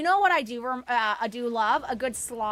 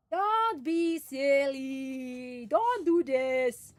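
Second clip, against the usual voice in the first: very slow, stretched-out words; mock pleading; 4 dB louder, much slower than usual. Real, not acted. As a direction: drag every word out in playful protest. Teasing chat about being Canadian.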